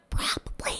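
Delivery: whispered aside. Real, not acted. Host admitting she might feel it too, whispered. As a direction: whisper it like a confession. Sheepish admission under the breath.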